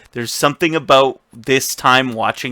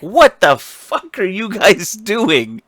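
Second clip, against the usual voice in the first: high, drawn-out incredulous quote; mock outraged disbelief; 8 semitones higher, slower than usual. Tyrion walks in on the beating, mock outraged disbelief. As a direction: act out walking in appalled, stretching the words.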